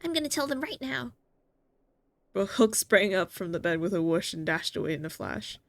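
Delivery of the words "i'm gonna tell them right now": put-on higher, eager little girl; bubbling childish wonder; excited kid voice. Voicing Hook excited.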